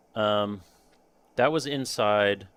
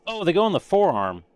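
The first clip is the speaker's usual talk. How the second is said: higher, louder "oh" of discovery; sudden realization; 8 semitones higher, 6 dB louder than usual. Real, not acted. Streamer finding where the forearm parts go, sudden realization. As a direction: blurt it as it dawns on you.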